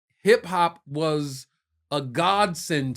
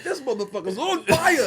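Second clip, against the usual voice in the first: high, laughing exclamation; laughing amazement; 13 semitones higher, 5 dB louder than usual. Retold or imitated. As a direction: crack up while you say it. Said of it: "I was like".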